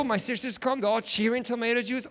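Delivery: normal talking voice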